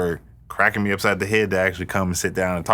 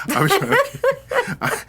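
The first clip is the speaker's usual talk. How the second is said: mock high squeak; slower than usual. Teasing wife's voice.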